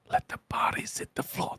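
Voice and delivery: hushed, voiceless whisper; whispered lyric. Acted. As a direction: whisper it like a sneaky dark joke. Quotes lyric as a dark joke.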